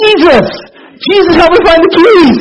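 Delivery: high, loud exultant call to Jesus; jubilant cry. Retold or imitated. Narrator crying out the prayer to Jen, high and loud in triumph. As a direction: proclaim it triumphantly.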